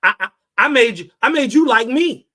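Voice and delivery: loud, stammering boast; hammed-up stuttering "I made you"; mock stammering god. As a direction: stutter out a flustered claim. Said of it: "He said".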